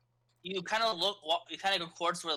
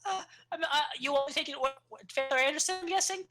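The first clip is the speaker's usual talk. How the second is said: put-on nervous kid voice; 10 semitones higher than usual.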